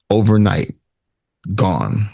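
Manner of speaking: ordinary delivery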